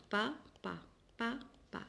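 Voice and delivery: "pa, pa" as separate short beats, softly; syllable by syllable. Shown as a demonstration. Teacher showing pupils how papa is written, syllable by syllable. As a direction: break papa into "pa" and "pa", pausing between. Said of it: "on écrit papa".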